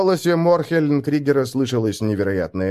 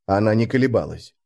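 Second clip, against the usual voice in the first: ordinary delivery, nothing marked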